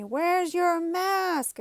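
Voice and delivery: slow, high, loud yelled demand; retold scream. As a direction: bark the question out across the room, stretched. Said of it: "I hear someone screaming at me".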